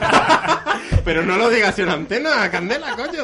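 laughing don't say it on air; laughing protest. Real, not acted. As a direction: laugh while scolding her.

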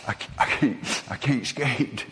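put-on breathy hushed child's sob; tearful boy. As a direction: whisper it like a crying little boy. Crying child answers father.

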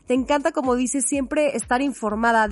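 usual speaking voice

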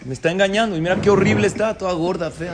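hammed-up furious wife, half laughing; jealous outburst. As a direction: spit it out as a betrayed wife. Wife jealous at hidden woman.

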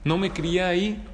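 usual speaking voice

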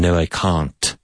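long broad ah in can't, No, I can't; well-spoken Londoner saying can't as cahnt; London vowel. Shown as a demonstration. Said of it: "a difference in the vowel sound".

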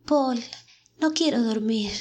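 high-pitched phantom voice; whispering ghost. A narrator's reading. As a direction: plead faintly like a fading ghost. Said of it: "susurra la voz del fantasma". (voz susurrante de fantasma)

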